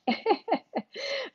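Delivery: a quiet, high little laugh; soft high laugh. Real, not acted. Relieved that viewers laugh, soft high giggle.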